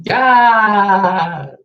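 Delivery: high, loud joyful yes; excited cheer. Real, not acted. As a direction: shout it out with joy. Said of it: "laughter yoga cheer".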